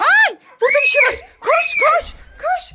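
put-on high, loud alarmed call to the kids; excited alarm. Mother rallying her kids, high excited shout. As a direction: sound the alarm, thrilled and shrill-high.

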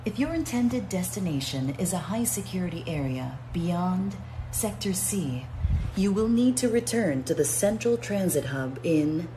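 even, measured transit announcer read; calm station announcer voice; automated announcement. Shown as a demonstration. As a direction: deliver it like a recorded train PA.

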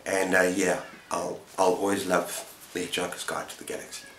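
ordinary talk

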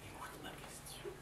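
hushed whisper to a pupil. Real, not acted. Teacher whispering to the boy who keeps interrupting, hushed. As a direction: whisper it to calm him down.

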